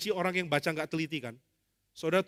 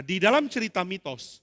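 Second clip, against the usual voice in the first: ordinary talk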